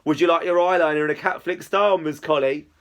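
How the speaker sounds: mock-posh English beautician; overly posh voice. A narrator's reading. Izzy teasing friend about eyeliner, overly posh voice. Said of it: "she says in an overly posh voice".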